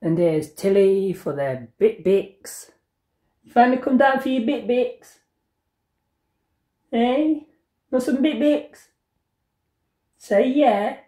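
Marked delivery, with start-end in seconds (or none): 3.4-11.0 pet talk. Real, not acted. Vlogger greeting her cat who came down, high pet talk. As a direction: tease the cat in a high sing-song.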